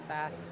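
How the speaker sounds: "Sass" with a flat short a as in cat; sass not sauce. Shown as a demonstration. Announcer demonstrating her Maritime vowel in "Sass". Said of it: "she thought I was saying sauce".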